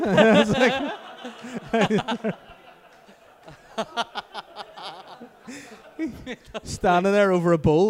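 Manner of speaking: high, loud helpless laughter through words; can barely get it out for laughing; laughing hard. Real, not acted. Telling the curry story, laughing hard.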